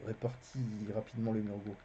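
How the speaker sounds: plain reading voice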